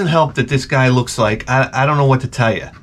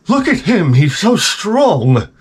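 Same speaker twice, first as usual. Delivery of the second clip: swooning women; 6 semitones higher, slower than usual. Retold or imitated. Women swooning over the wheelchair coach.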